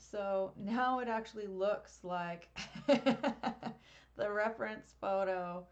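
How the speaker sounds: amused, breaking into a real laugh mid-sentence; laughing at oneself. Real, not acted. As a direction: crack up at your own mistake as you talk. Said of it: "I'm laughing so hard at myself".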